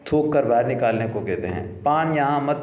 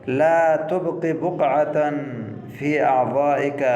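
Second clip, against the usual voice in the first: slow model phrase; slower than usual. Shown as a demonstration. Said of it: "teacher models phrase".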